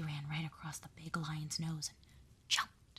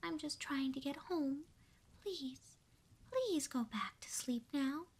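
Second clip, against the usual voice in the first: pleading mouse; 7 semitones higher than usual. Acted. Tiny mouse begs the lion.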